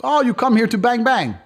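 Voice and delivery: high, mocking broken English accent; broken English local. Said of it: "He goes".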